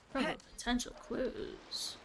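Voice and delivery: slow breathy whisper of the phrase. Real, not acted. Streamer whispering back the game's narration, hushed and slow. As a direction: savour it under your breath, slowly.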